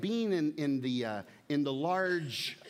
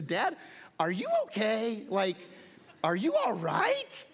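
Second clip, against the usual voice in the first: put-on teen daughter; 7 semitones higher than usual. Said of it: "looks at me and says".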